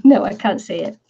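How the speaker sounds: ordinary talk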